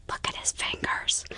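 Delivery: hushed, unvoiced whisper to a friend. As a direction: whisper it furtively, barely audible. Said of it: "what exactly did you whisper".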